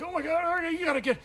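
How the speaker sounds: high, pushed self-urging; mock strained urging; strained pep talk. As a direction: force yourself up through pain. Urging himself up in pain.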